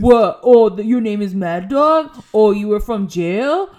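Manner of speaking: loud, slow sneering taunt; imitating a bully mocking the new guy. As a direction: jeer at him with mock disbelief. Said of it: "He's like".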